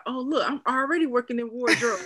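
texting Stevie the screenshot; excited brag. Retold or imitated. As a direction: show off playfully. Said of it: "I was like".

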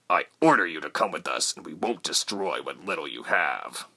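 lightly voiced soldier, controlled threat; commanding soldier. A narrator's reading. Soldier gives orders to captive.

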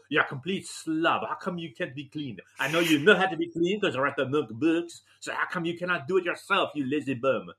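scolding wife in a light mock French accent. Husband imitating his French wife scolding him as a slob, light French accent. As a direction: nag like a French wife, half-joking. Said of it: "She says".

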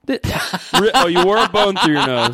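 cracking up in disbelief; laughing. Real, not acted.

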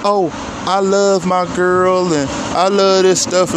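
high, slow, mocking lovesick voice; mocking cheaters. Mocking cheaters who claim they love their girl, high slow voice. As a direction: ham up a boyfriend swearing he loves his girl, pitched high. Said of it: "motherfuckers talking about".

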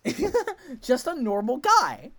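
high, loud laugh through the words; laughing ironic. Real, not acted. Reacting to Richard's lines, laughing. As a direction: laugh off the horror. Mock horror breaking into laughter.

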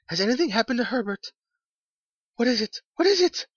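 high, loud, panicky questions, climbing; breathless alarm. A narrator's reading. Mother fears for her son Herbert, breathless and frantic. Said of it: "she asked breathlessly".

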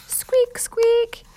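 high, loud squeaky mouse noise; mouse squeak. Acted. Peanut the mouse squeaks in alarm, high and loud.

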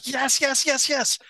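high, loud excited yeses; hammed-up, high-pitched eager agreement; imitated excitement. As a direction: mimic an overexcited man confirming a guess. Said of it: "he was so excited".

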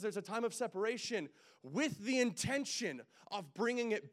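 high, loud, slow pulpit exhortation; fired-up preacher pushing the church to act; preaching at full force. Acted. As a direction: drive it home to the congregation, slow and loud.

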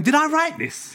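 high-pitched, incredulous question to oneself; surprised disbelief. Retold or imitated. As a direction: voice leaps up in amazed self-doubt. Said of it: "I was surprised myself".

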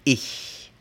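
ich as if with a pencil in the teeth; German ich sound. Shown as a demonstration. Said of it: "Lo que va a salir es".

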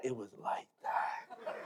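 low, slow, hushed comic insistence; mock insistence. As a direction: drag it out softly in a low voice. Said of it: "let me lie a little bit more".

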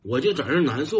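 normal talking voice

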